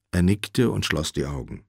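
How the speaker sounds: plain reading voice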